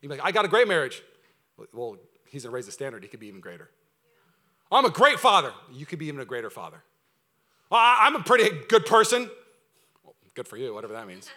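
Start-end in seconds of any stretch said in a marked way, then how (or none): 0.2-1.0 loud, higher, self-satisfied protest; mock boasting. Mimics a self-satisfied objector. 4.7-5.5 loud, higher, proud protest; mock boasting. Mimics a self-satisfied objector. 7.7-9.3 loud, higher, stammered self-defence; mock protesting. Mimics a self-satisfied objector.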